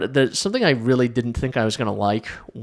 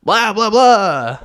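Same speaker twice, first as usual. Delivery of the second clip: put-on slow, high, loud Transylvanian accent; hammy Dracula Transylvanian accent; 9 semitones higher, 8 dB louder, much slower than usual. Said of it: "his shitty Transylvanian accent".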